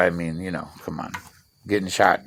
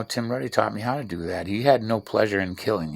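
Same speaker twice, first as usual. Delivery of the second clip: ordinary delivery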